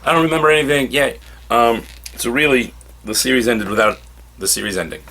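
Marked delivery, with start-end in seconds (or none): 0.0-1.2 lightly voiced, higher-pitched man coming round; puzzled, raised voice piecing things together; waking character. Hero wakes up to find the dead alive, lightly voiced and puzzled. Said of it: "he wakes up and goes".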